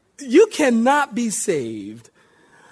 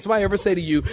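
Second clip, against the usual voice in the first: ordinary delivery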